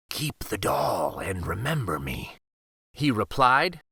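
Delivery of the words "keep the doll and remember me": low breathy whisper of a parting wolf. Acted. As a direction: whisper it like a wolf saying goodbye. Wolf's farewell, whispered.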